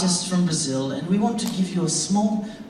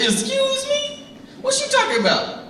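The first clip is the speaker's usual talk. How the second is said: put-on regional accent; 3 dB louder than usual. Street flirtation comeback, high put-on regional accent. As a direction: play the startled comeback way up high. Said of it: "a mix of regional accents going on".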